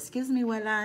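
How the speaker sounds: high, polite little-boy imitation; a hint of a five-year-old; lightly voiced grandson. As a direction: imitate a small child seeking grandma's attention.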